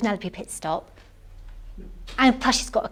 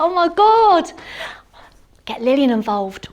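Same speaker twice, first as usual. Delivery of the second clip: ordinary delivery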